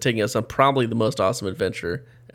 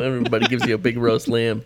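usual speaking voice

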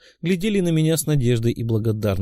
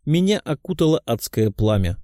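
plain reading voice

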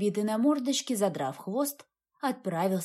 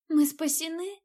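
hoarse; 7 semitones higher than usual. Acted. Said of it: "хрипло спросила она".

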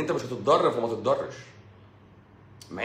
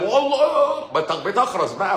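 much higher, louder and slow; mock outburst; 8 semitones higher, 5 dB louder, slower than usual. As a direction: burst out in a mock cry. (انفجار ساخر بصوت عالٍ جدًا)

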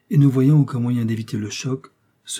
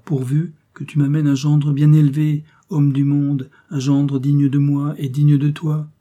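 slow, raised, insistent demand; emphatic father; 3 semitones higher, 5 dB louder than usual. A narrator's reading. Father dictating terms for her marriage, emphatic and slow. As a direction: lay down the condition, slow and louder.

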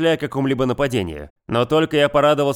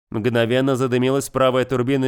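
ordinary delivery, nothing marked

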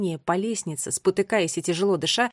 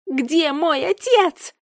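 high, loud desperate demand; full-throated scream; 8 semitones higher, 7 dB louder, much slower than usual. A narrator's reading. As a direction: scream it slowly at the ceiling. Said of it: "закричала она во всё горло". (отчаянный крик во всё горло)

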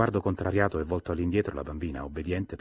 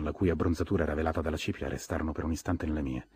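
ordinary delivery, nothing marked

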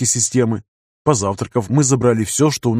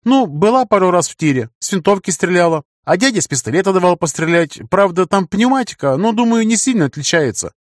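put-on lightly raised girl's voice; young woman voice; 7 semitones higher than usual. A narrator's reading. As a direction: voice her a bit higher. Narrator voices Света.

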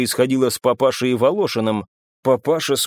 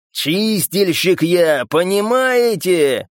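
slow, higher, drawn-out growl; growled boast; 5 semitones higher, 3 dB louder, much slower than usual. A narrator's reading. Славик to the men he fights, growling. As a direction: growl it out, stretching the words. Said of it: "рычал Славик".

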